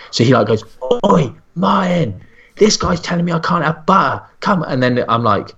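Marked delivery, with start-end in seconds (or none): 1.1-4.6 high-pitched, indignant Welsh shout-out; Welsh with attitude. Retold or imitated. Welsh weightlifter complaining to nutritionist about butter, indignant Welsh. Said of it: "this guy's Welsh, like, with, like, such an attitude".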